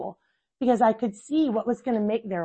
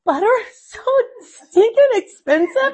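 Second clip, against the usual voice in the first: high, loud mock outrage; exasperated squeal; 13 semitones higher, 8 dB louder than usual. Mom to baking daughter about butter, high exasperated squeal. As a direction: wail about the price like it's a tragedy. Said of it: "I'm like".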